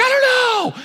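high, loud helpless cry; yelling back at the friend; yelled back. Retold or imitated. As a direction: shout back, clueless. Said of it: "I'm like".

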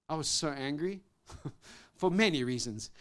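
usual speaking voice

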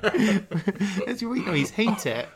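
high, loud, drawn-out Norfolk accent; exaggerated Norfolk demo. Shown as a demonstration. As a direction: stretch it in broad Norfolk, amused. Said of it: "the Norfolk accent is like".

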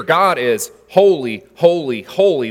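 "holy, holy, holy" each word weighted and slowed; emphatic triple holy. Shown as a demonstration. Preacher to congregation, stressing "holy, holy, holy" slowly. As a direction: lean on every "holy" in turn. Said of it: "This is the emphasis".

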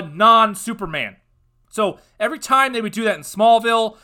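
loud, heated rant about losing powers; fed-up fan venting loudly; angry rant. Real, not acted. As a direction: rail against the plot twist. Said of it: "I apologize for that outburst".

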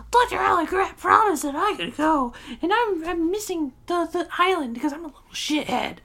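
mock high-pitched bratty whine; put-on squeaky, petulant complaint; whiny kid tantrum. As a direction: squeal it like an exaggerated pouting little kid. Mocking a pissy kid's complaint.

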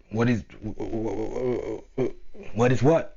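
dad stuck repeating the first sound; imitated stutter. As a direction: imitate getting blocked on "what", then push it out. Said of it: "what you just did".

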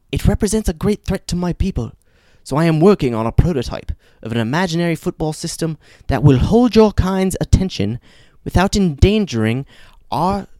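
put-on a tiny alien doing a posh British accent; fun British accent. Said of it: "says in a fun British accent".

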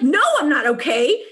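imitating her furious teen self; angry retort. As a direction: snap back at the boss, replaying it. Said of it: "I was so angry".

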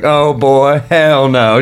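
high, loud sassy woman's refusal; imitating the headliner. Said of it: "she was hilarious about it".

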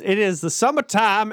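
usual speaking voice

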